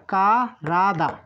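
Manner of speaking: কারাদা very slowly, lingering on "ka" before "rada"; syllable-by-syllable pronunciation. Shown as a demonstration. Teacher repeats the word for body for learners, slowly by syllable. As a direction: draw out কারাদা in separated parts. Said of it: "আরেকবার উচ্চারণ করে দেই".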